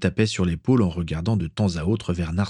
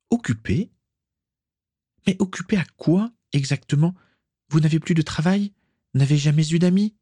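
higher, rising indignant questions; a bit too loud; 9 semitones higher than usual. A narrator's reading. Daughter confronts her father at the banquet, higher and too loud. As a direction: press him, voice climbing too loud. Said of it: "commençait à parler un peu trop fort".

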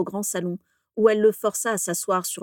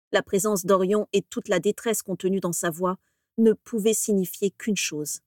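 plain reading voice

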